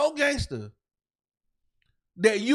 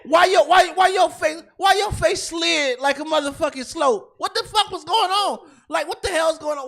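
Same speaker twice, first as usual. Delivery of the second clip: higher, louder incredulous mockery; laughing mocking rant; 5 semitones higher, 5 dB louder than usual. Real, not acted. Host mocking the defendant's face at the verdict, loud and higher. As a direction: laugh at him while yelling.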